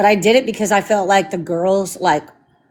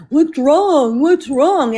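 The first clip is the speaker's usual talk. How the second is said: mocking the husband; 4 semitones higher, much slower than usual. Mimicking her husband who keeps asking what's wrong. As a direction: parrot him the way he nags. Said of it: "every fucking day".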